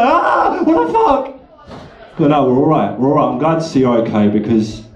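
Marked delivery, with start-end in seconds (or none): 0.0-1.3 much higher shrieked recoil of horror; mock horrified shout. Viewers recoiling from the telly, high mock shriek. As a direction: yelp it like you've seen the apocalypse on telly. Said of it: "Everybody's recoiling from the telly like".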